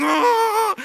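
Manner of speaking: high, loud wordless mock wail; terrified moan. Husband imitating his wife with eyes closed, wailing in fear. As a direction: imitate a petrified rider's held cry. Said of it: "not saying anything anymore".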